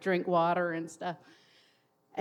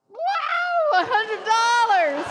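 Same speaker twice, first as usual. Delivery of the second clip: high, loud shout of delight, slowing on the amount; thrilled shout; 13 semitones higher, 6 dB louder, much slower than usual. Retold or imitated. Boxer handed a hundred dollar bill, shouting with joy. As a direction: yell it like you can't believe your luck.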